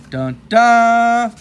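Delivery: sung suspense sting, loud; mock movie cliffhanger chord; hammed-up dramatic sound effect; dramatic dun dun. Dramatic sting after a question.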